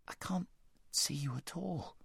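put-on high, hushed scared boy; frightened whisper. A narrator's reading. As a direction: whisper it in the dark, afraid.

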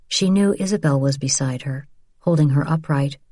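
ordinary delivery

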